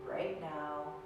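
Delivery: soft, slow meditation voice with long pauses; guided meditation. Acted. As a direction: ease the listener into stillness, softly and slowly. Leads a seated meditation.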